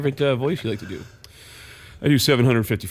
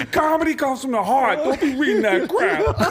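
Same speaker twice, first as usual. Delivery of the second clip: much higher, laughing put-on voice; Tracy Morgan impression; 11 semitones higher than usual. Tracy Morgan scolding the kid with the notebook. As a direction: preach it like Tracy lecturing a kid. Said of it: "He goes".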